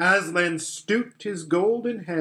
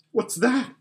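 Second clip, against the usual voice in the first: startled; 8 semitones higher than usual. A narrator's reading. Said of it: "clutching Susan's arm".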